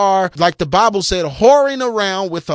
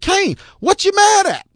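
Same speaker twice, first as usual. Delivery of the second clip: God speaking casually; 11 semitones higher than usual. Acted. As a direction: tease Cain like a disappointed father, way up high. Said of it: "So the Lord said".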